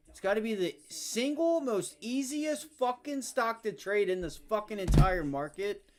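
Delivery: yelling in real rage, high and loud; furious rant. Real, not acted. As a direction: scream at the screen in fury. Trader raging at market makers.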